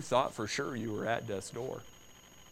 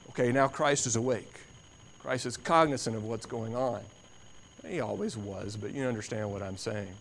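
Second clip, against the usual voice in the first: ordinary delivery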